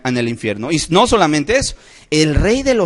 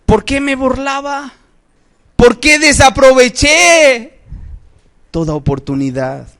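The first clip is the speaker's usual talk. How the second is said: regretful wail; 9 semitones higher, 3 dB louder than usual. Retold or imitated. Preacher voices a lost soul lamenting, high wailing regret. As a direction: cry out in bitter regret. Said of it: "escucharás a lo mejor".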